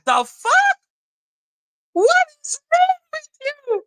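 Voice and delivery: high, incredulous shout; outraged yell. Real, not acted. Reacting to the Avengers' hypocrisy, with an incredulous shout.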